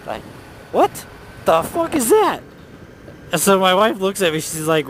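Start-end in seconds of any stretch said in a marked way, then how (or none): 0.7-2.4 high, shocked cry of disbelief; startled outburst. Retold or imitated. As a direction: jump out of your skin at a sudden noise. Startled by toy speaking.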